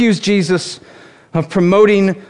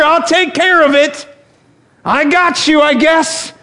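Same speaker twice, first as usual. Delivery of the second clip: mock scolding; 6 semitones higher than usual. Preacher imagines Jesus scolding the wedding family, mock indignant. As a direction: scold them like a put-out host, in jest.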